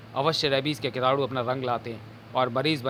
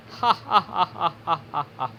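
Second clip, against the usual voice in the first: villainous cackle; a gloating evil laugh; 3 semitones higher than usual. Acted. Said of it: "तीनों के खूनी ठहाकों से". (खूनी ठहाका)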